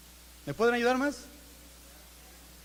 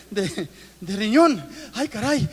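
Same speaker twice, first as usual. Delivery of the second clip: lo dice entre risas; riéndose; 5 dB louder, slower than usual. Real, not acted. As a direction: suelta la palabra riéndose. Bromea sobre el diagnóstico. (bromea ante la congregación, riéndose)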